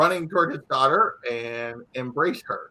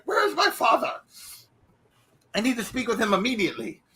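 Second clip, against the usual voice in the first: higher, quick demanding woman; urgent daughter; 7 semitones higher, faster than usual. Acted. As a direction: rush it out, she is on a mission. Daughter demands her father.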